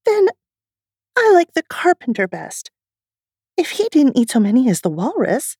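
put-on decide it firmly like a little girl; Alice voice. Alice speaks in story.

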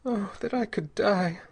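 high, despairing woman's voice; constrained, high-voiced despair; through stiffened lips. A narrator's reading. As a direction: wish it hopelessly, pitched high. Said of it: "She spoke through stiffened lips".

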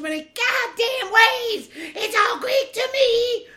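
high, slow mock confused voice. Mocking a confused listener.

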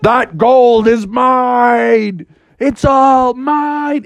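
drawn-out possessive growl; slow greedy bear. Acted. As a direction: claim the gold slowly and hungrily. Bear charging.

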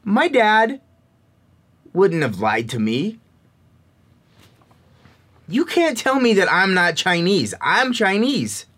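high, stubborn insistence; mild childish defiance; frustrated child. Retold or imitated. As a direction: dig in like a hurt kid. Frustrated child's inner thoughts.

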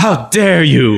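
hammed-up indignant scolding; Greta Thunberg. Friend mocking a scold over overused Keanu, mock-indignant. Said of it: "turn into a little Greta Thunberg".

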